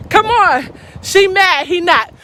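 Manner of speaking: ordinary talk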